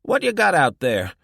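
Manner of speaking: high, loud rough call into the dark; harsh old woman. A narrator's reading. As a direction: call out suspiciously at the noise. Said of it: "a harsh voice called".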